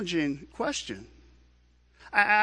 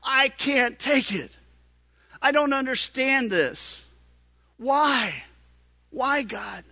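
crying out in agony; 8 semitones higher than usual. Acted. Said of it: "Jesus cries out in agony".

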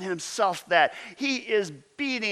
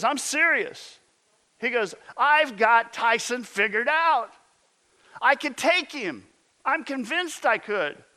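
higher, earnest insistence, lightly voiced; insistent friend, mock; 6 semitones higher than usual. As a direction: mimic a stubborn buddy, half-joking. Friend insists he can beat Tyson.

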